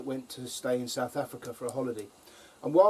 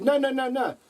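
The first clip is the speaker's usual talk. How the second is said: put-on high, insistent South African accent; mock South African lady; 11 semitones higher, 3 dB louder than usual. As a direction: imitate the South African masseuse. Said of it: "It's all in the accent".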